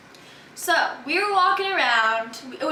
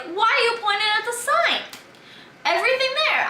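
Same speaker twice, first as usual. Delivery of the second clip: higher, exasperated protest; incredulous protest; 9 semitones higher than usual. Retold or imitated. Teen arguing with ride operator, higher incredulous protest. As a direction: push back in disbelief, pitch climbing. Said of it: "And I was like".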